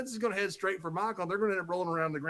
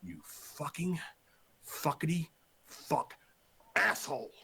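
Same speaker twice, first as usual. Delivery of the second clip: quiet seething, words spaced out; Vince losing it; 3 dB quieter, slower than usual. Retold or imitated. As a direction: imitate a boss too furious to get the words out. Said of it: "Vince has lost it".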